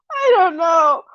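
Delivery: high, half-shouted through laughter; laughing. Real, not acted. Host tells chat she can't tell, blurted through laughter.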